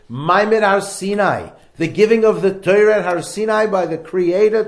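booming, impassioned preacher's voice; fervent preaching. Acted. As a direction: preach it out loud to the crowd. Preaching at full force.

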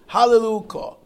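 high, very loud proclamation; exultant praise. Shown as a demonstration. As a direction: proclaim it as one triumphant word. Praise as one word, demonstrated.

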